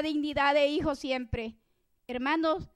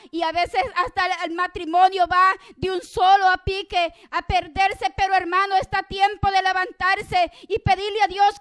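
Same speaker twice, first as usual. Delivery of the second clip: raised, higher preaching voice; fervent exhortation; 6 semitones higher, 11 dB louder than usual. Real, not acted. Preacher urging a brother to get up, fervent exhortation. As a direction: press the congregation urgently, louder and higher.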